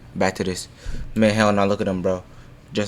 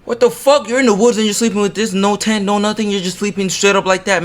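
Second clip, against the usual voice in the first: high-pitched loud disbelief, easing down at the end; incredulous outburst; 11 semitones higher, 4 dB louder than usual. Real, not acted. Streamer reacting to sleeping in the woods, incredulous outburst. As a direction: exclaim in baffled shock, pitched way up.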